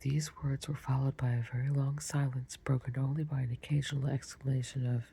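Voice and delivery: usual speaking voice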